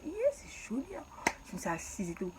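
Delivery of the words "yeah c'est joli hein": put-on higher, English-tinged accent; accent anglais frimeur; her put-on accent. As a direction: show off a foreign accent. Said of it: "Avec mon accent".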